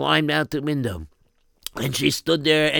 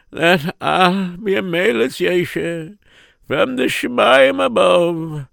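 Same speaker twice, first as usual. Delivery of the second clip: ordinary delivery, nothing marked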